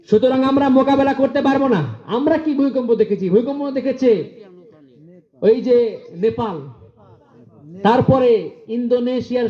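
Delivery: high, ringing preacher's cry; fiery preaching. Acted. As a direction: preach it out to the crowd. Preaching at full force.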